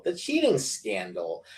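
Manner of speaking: usual speaking voice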